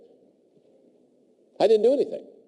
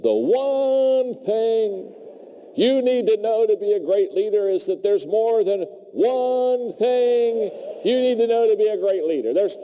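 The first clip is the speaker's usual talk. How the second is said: much higher, slow and forceful; energized retort; 11 semitones higher, slower than usual. Retold or imitated. Speaker lecturing the impatient MBA kid, high and forceful. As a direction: rise up and lay it down to him. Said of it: "got all my energy".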